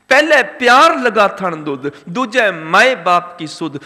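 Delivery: measured, emphatic intoned verse; scripture recitation. Real, not acted. Preacher recites verse on childhood's successive loves, emphatic intoned delivery. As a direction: recite each stage of the verse with drawn-out weight.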